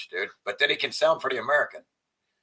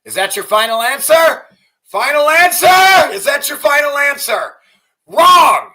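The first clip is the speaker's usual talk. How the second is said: high, loud hammed-up quiz host ending in a shouted Wrong!; mock game-show host; 13 semitones higher, 13 dB louder than usual. Streamer mocking Tate's comeback as a quiz host, loud and high. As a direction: play a gloating TV quiz host, then yell the verdict.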